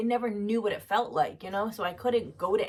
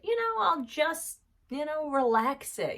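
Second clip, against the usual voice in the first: lightly mocked self-reassurance, pitched up; mock casual bargaining; 8 semitones higher than usual. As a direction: draw it out high, as if brushing off the problem. Mimicking her own past self-bargaining.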